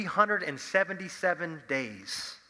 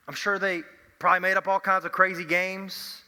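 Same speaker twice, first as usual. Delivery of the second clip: normal talking voice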